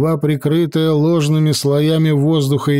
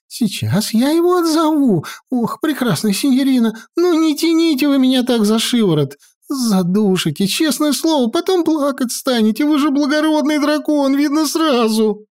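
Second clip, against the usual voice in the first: much higher, flustered wizard complaining; muttering fussy wizard; 11 semitones higher than usual. Acted. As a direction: fuss and complain while being dragged. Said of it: "бормотал меж тем маэстро".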